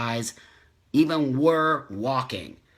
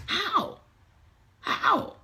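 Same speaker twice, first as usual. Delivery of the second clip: much higher, the second almost breathless; exasperated disbelief. Real, not acted. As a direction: plead in disbelief. Exasperated plea about infighting.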